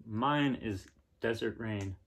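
usual speaking voice

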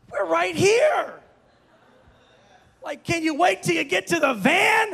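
high, loud indignant outburst; upset. Retold or imitated. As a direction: retell it as an exasperated dad calling out. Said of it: "I'm just getting upset".

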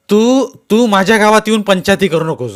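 loud, stammering fake courage; false bravado. A narrator's reading. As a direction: bluster to hide his fear. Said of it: "खोटं अवसान आणून तो म्हणाला". (खोटं अवसान आणून)

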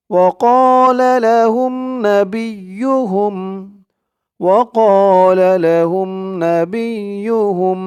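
slow recited وقال لهم with a long alif; Quran recitation. Shown as a demonstration. Teacher reciting for students to repeat. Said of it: "আমার সাথে সাথে ঠোঁট নাড়িয়ে বলবেন".

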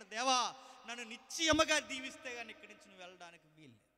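high, loud desperate plea; crying out to God. Acted. Jacob at the river begging God, loud tearful plea. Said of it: "ఏడ్చి అన్నాడట".

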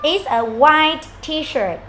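slow, clear teacher demo; slow model answer. Shown as a demonstration. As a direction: drill it slowly. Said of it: "cô sẽ nói màu nhá".

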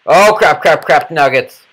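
loud, high frantic yelling; panicked shouting. Real, not acted. Player in sudden trouble in the game, panicked shouting. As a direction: shout it over and over in rising panic.

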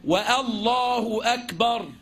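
والله أكبر with a و added at the start, said slowly; slow والله أكبر with a leading و; waw before Allah. Shown as a demonstration. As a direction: begin with an extra و in والله أكبر. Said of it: "ما تقولش".

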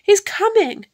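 high, loud giantess warning; alarmed cry. A narrator's reading. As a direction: warn urgently that danger is near. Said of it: "cried the giantess".

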